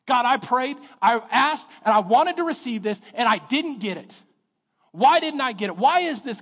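loud, high complaint to God; a hurt believer demanding answers; mock angry prayer, loud and high. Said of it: "first reaction ... is anger".

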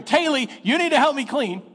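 put-on high, loud whiny child's nag; the tired brother nagging yet again; mock child nagging; kid nagging sister. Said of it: "after about thirty minutes of hearing".

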